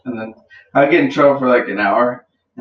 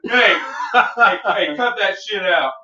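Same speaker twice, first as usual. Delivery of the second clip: cop voice; 6 semitones higher, slower than usual. Retold or imitated. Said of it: "they're like".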